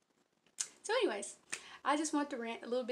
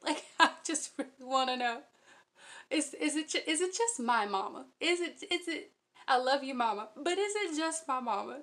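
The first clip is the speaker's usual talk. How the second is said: real giggles breaking into louder, insistent questions; can't stop giggling while asking; 2 semitones higher, 7 dB louder than usual. Real, not acted. Crafter asking viewers about her mom's odd request, giggling. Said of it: "I can't stop giggling".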